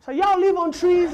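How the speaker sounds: high, loud wide-eyed question; naive kid. Retold or imitated. Kid asking the comic about Africa, high loud naive question. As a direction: ask like a gullible kid. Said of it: "he's like".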